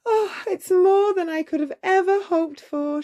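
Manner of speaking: put-on high, breathless girl's delight; delighted declaration. A narrator's reading. Cinderella seeing her gown, in high delight. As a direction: declare it in wonder. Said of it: "she declared".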